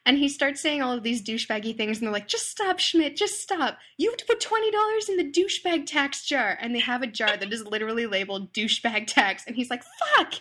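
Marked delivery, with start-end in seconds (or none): 2.3-6.6 high, scolding mock voice; exasperated roommates. Roommates making Schmidt pay the tax, high exasperated scolding. 9.8-10.3 very high, loud frustrated yelp; mock outburst. Schmidt forced to pay the jar, high yelp. Schmidt paying douchebag tax.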